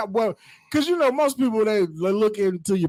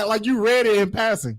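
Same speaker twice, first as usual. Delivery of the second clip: ordinary talk, nothing marked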